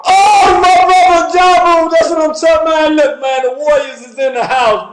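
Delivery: high, loud joyful shout of greeting; excited shout. Real, not acted. Host loudly welcomes caller Jabu onto the show. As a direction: hype up the caller's arrival.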